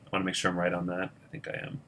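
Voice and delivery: very soft, quick, low aside; hushed, rapid double-checking to yourself; talking to oneself. Real, not acted. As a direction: murmur a quick self-check under your breath. Checking own arithmetic to self.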